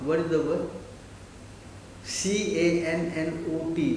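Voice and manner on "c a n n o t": slow letter-by-letter spelling. Shown as a demonstration. Teacher spelling cannot for the class, slow spelling. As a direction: spell it slowly, each letter separate.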